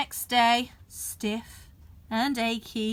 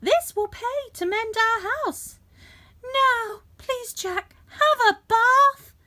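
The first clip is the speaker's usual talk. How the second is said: mum's voice; 11 semitones higher, 4 dB louder than usual. A narrator's reading. Mum voiced in story.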